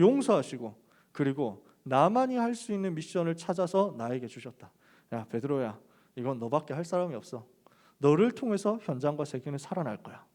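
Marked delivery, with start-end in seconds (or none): 5.1-10.2 soft tender encouragement. Retold or imitated. Jesus tenderly encouraging Peter, retold.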